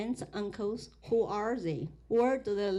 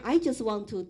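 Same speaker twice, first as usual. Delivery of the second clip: usual speaking voice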